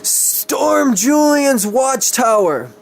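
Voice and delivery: high, slow, shouted order; hissed command. Acted. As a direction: bark the order, drawn out and high. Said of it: "hissed into his digitalisman".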